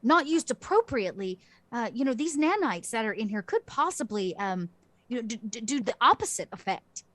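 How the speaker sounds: high opening settling toward mid pitch; animated character voice, starting high; assessing character. Acted. Doctor character explaining the healing helmet's risk, animated puzzled voice. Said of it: "She goes".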